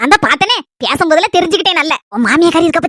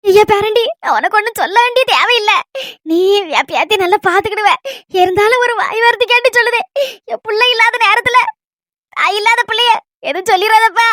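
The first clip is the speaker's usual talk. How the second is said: higher-pitched, weepy grandmother's plea; tearful pleading; 4 semitones higher than usual. Acted. Grandmother entrusting her granddaughter to the young husband, tearfully pleading. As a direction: plead through tears, pausing between phrases. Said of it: "அழாதுங்க பாட்டி".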